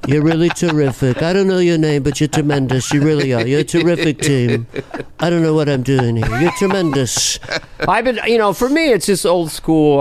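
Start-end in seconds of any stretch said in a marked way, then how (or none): 0.1-7.4 gushing president doling out praise; mumbly New York presidential impression; Trump. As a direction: pour on presidential superlatives. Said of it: "Make me Trump".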